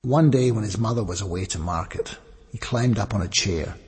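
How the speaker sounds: usual speaking voice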